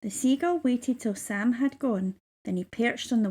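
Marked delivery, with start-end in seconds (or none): none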